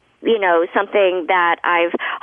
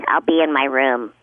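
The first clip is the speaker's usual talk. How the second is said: dry delivery; 6 semitones lower than usual. Retold or imitated. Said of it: "dry delivery".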